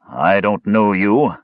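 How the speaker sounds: slow, pointed retort; shooting back. A narrator's reading. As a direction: throw the words back defiantly. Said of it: "the villager shot back".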